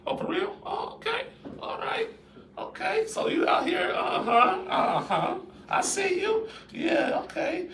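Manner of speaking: high, suspicious mock spouse voice; jealous spouse. Wife hearing about the compliment, high and mock-suspicious. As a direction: needle him like a wife who just caught on. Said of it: "your mate be like".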